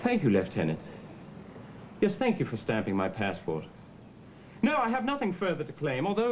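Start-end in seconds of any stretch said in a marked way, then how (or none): 0.1-0.8 a put-on British accent, polite and proper. Thanking the lieutenant for stamping his passport, in a British accent. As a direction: play a courteous Englishman at passport control. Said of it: "That was a British accent".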